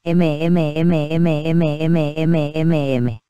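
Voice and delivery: long steady hum, lips closed; closed mouth humming. Shown as a demonstration. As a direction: hum the M through the nose, mouth shut. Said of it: "exhalen por la nariz y emitan ese sonido". (zumbido con la boca cerrada)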